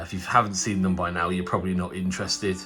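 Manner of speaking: ordinary talk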